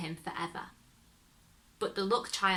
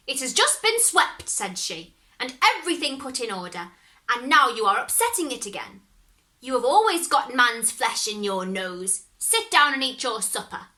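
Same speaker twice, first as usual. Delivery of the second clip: scolding; 3 semitones higher, 6 dB louder than usual. Acted. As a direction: nag him hard. Grandmother scolds devil.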